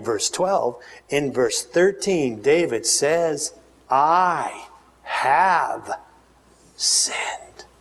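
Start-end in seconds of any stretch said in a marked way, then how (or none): 3.9-7.7 broken confession. Acted. David confesses his sin.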